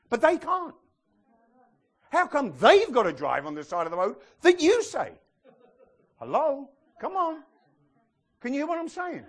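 high, loud incredulous scolding; exasperated challenge. Acted. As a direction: challenge them with pitch shooting up. Acted exasperated reply to the traveller.

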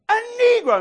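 high loud surprised cry; amazed question. Acted. Woman carrier echoes him, high surprised cry. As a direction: squeal the question in wonder. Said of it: "and he goes".

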